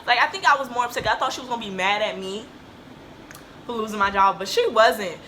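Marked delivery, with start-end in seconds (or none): none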